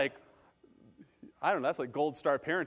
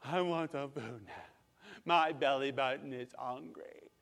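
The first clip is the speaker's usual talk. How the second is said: slow little-kid whine, imitated; softly drawn-out kid voice; whining hungry child; 4 dB quieter, slower than usual. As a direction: put on a small child's hungry complaint. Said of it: "She says it in her own special way".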